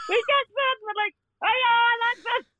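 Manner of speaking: high, giggly retelling; real laughter pushing the pitch up; laughing. Real, not acted. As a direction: squeeze the words out through laughter.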